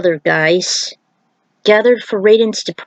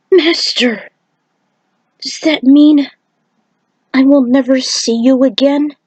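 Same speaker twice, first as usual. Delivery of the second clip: higher, anxious little voice; worried child; 5 semitones higher than usual. Acted. Boy asking his departing master, a touch higher and worried. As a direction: ask it like a small boy afraid. Boy asks Raiden at farewell.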